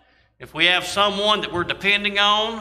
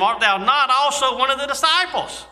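higher, pointed accusing question; 7 semitones higher than usual. Acted. Preacher voicing servants questioning Peter, higher. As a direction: put the question to him like an accuser.

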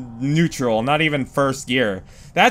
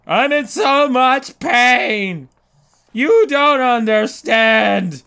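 higher, louder, slow drawn-out mock complaint; hammed-up pained wail; 6 semitones higher, 3 dB louder, much slower than usual. Gamer hamming it up to viewers, slow pained wail. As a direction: overplay the suffering. Whining about in-game pain.

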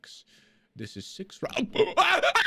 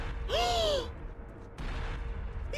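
gasp; 6 dB quieter than usual. Real, not acted.

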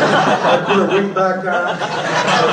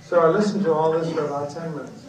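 usual speaking voice

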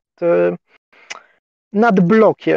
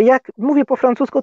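ordinary delivery

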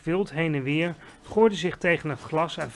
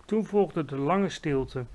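plain reading voice

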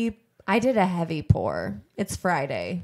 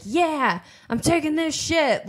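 proud defiance; 8 semitones higher than usual. Retold or imitated. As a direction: own it like a cocky punk. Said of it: "she's like".

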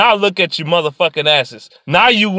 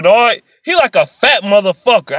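usual speaking voice

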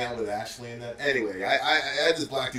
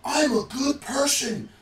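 retold yell; 9 semitones higher than usual. Coworker yelling at supervisor, imitated in a high mock yell.

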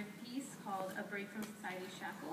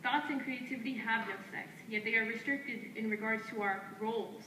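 firm, declaiming poetry lines traded back and forth; spoken word duet; 6 dB louder than usual. Acted. Two poets trading lines on stage, in a firm declaiming delivery. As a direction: answer your partner line by line like a chant.

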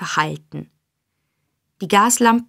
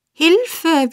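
high, exaggerated echo of a cry; mocking repetition; 9 semitones higher than usual. A narrator's reading. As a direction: throw the cry back mockingly. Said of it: "wiederholte der Mann". (höhnisch nachgeäfft)

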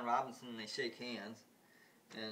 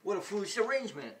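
much higher and louder, mocking; scornful ruler; 9 semitones higher, 10 dB louder than usual. Ruler mocks equal women, loud and high. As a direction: sneer at the idea. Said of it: "the ruler starts laughing".

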